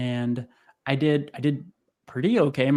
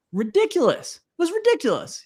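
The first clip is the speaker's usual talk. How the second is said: incredulous. Retold or imitated. Looking back amazed at his breakdown.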